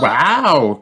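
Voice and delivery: put-on high child-like amazement; amazed gasp. A narrator's reading. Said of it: "gasp the children".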